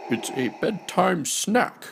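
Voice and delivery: high, nasal-sounding alien admiral; a fish admiral announcing a snack; Akbar voice. Acted.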